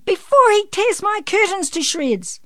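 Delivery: high, loud frantic yell. A narrator's reading. Mum fears for her curtains, yelling. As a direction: keep yelling in panic to the end. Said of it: "yelled Mum".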